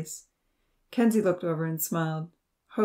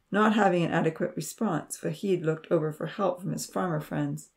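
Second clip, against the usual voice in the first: ordinary delivery, nothing marked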